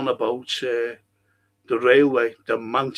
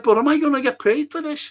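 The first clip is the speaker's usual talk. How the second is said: much higher indignant question; indignant demand; 12 semitones higher, 4 dB louder, faster than usual. Retold or imitated. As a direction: press them for the money. Demanding payment.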